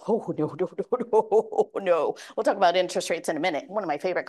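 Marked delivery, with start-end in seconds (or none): 0.1-2.2 mock refusing buyer. Acting a reluctant home buyer.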